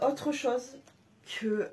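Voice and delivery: usual speaking voice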